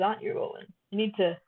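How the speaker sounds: ordinary talk, nothing marked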